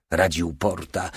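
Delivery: ordinary delivery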